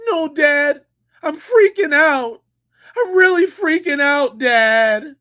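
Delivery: mock panic. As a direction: overplay the scared son crying to dad. Mocking son's fake panic.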